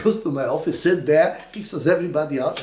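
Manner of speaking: usual speaking voice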